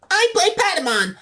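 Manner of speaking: very high squeaky creature voice; Patamon voice. Acted. Voice actor announcing his role in the squeaky Patamon voice.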